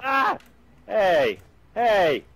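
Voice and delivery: sharp, high-pitched outburst of protest; indignant cry. Real, not acted. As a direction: call out in protest at a teammate. Protesting being shot by teammate.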